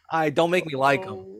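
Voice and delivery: ordinary talk, nothing marked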